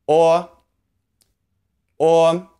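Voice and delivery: "o" as a tall, rounded vowel; Chinese O sound. Shown as a demonstration. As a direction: hold "o" long and high with puckered lips. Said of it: "the O sound is".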